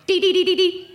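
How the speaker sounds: very high, loud rapid "Dee-dee-dee"; intense chickadee call. Retold or imitated. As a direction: make it an urgent alarm chirp. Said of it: "they just go".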